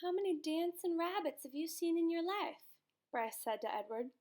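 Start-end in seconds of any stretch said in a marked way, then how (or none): 0.0-2.8 put-on higher, eager boy's voice; a boy bursting with his idea; eager boy pitching a plan to his doll; lively boy. A narrator's reading. Bryce voiced in story.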